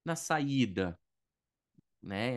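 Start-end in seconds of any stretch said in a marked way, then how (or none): 0.1-1.0 wrong phrasing quote. Retold or imitated. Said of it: "a gente não fala".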